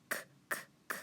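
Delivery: "k" as soft voiceless clicks; isolated "k", no vowel; K sound. Shown as a demonstration. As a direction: sound out "k" three times, with gaps. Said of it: "it can be a C".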